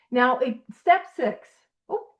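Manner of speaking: normal talking voice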